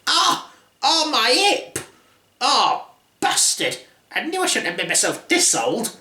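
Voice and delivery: put-on high yelp of a hurt old hag; mock-pained high old crone. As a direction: yelp up high, hip gone. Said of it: "falling over and going".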